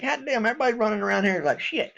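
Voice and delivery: annoyed veteran's mock complaint; exasperated outburst. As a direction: vent it like you've had enough. Veteran venting frustration at civilians.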